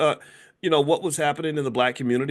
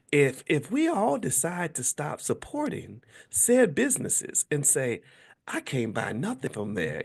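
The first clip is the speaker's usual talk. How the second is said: lightly voiced sweet gentle woman; Tabitha Brown voice; 4 semitones higher, 3 dB quieter than usual. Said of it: "I can't do Tabitha Brown".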